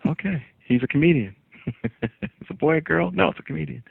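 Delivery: a mock doctor announcing the birth; doctor at delivery. Comedian jokes about his birth, mock doctor voice. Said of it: "And he goes".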